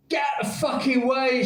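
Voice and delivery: high angry yell from the mob; angry shout. Retold or imitated. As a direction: shout it at the stuck car. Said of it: "shouted someone".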